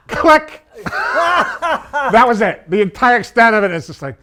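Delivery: laughing loudly through the words; can't stop laughing while telling it; real laughter, loud and high. Real, not acted. Laughing at the prank.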